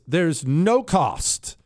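"There's no cost." slow, with weight on "no"; stressed, slow. Shown as a demonstration. Host stressing to listeners that the courses are free, heavy stress on "no". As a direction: lean hard on "no cost", drawn out. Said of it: "I'm gonna stress this".

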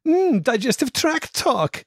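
put-on high, drawn-out camp German accent. Eldest lad blurting out at the snake slide, in a camp German accent. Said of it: "let out a gloriously camp".

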